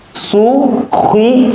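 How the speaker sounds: high, crisp Quran letters; fast, firm recitation. Shown as a demonstration. Teacher shows pupils fast, firm letter reading, high and crisp. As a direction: snap out each syllable firmly. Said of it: "Bacanya cepat, tegas, dan benar".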